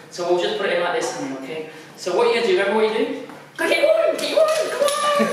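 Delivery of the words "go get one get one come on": very high, eager dog-calling voice; excited dog cheering. Real, not acted.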